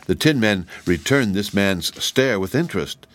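ordinary delivery, nothing marked

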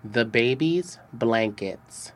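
usual speaking voice

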